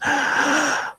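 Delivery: a sudden high, sharp gasp. Acted. Stabbed fighter gasping as the blade slides through her back. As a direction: suck in air as the sword goes through. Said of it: "inhales sharply".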